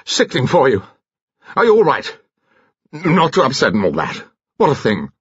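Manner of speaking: high, blustering gentleman's voice; agitated, jerky character voice; flustered commander. A narrator's reading. Flustered man blurts sympathy after shouting.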